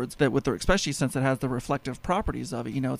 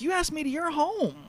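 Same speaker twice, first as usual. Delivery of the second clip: higher indignant reply; 13 semitones higher than usual. Retold or imitated. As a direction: hold your ground, pitched up. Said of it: "I'm like".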